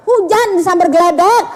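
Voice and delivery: loud, high comic exclamation; indignant outburst. Acted. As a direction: blurt it out as an appalled husband. Husband's acted outburst at kiss request.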